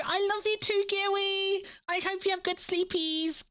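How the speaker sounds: high, cooing goodnight voice. Real, not acted. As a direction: coo it like a lullaby. Sweet goodnight to viewers.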